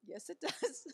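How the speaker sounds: laughing insistent yes; laughing retort. Retold or imitated. Mother replaying her child's laughing insistence. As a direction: toss it back through a laugh. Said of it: "arguing with daughter over spelling".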